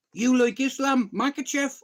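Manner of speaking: high, loud, delighted disbelief; retold burst of excited recognition; excited question. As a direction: ask it high and loud, amazed. Acting out the man's delighted question.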